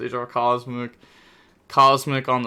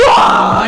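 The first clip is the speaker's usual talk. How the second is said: strangled gasp; 13 dB louder than usual. Real, not acted. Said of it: "I'm actually heated".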